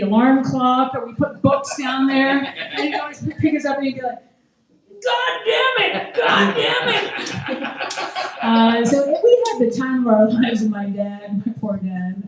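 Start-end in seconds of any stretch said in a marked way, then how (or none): 5.0-7.9 high, drawn-out angry shout; mock furious dad shout, much higher and slow; imitating dad's furious yell. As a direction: yell it like the enraged father. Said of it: "when he got really angry".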